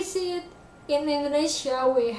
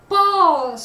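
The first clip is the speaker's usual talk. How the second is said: heavily stressed, lengthened PAUSE; drawn-out stress; 5 semitones higher, 8 dB louder than usual. Shown as a demonstration. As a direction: PAUSE loud, high and long. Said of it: "very long rather than the".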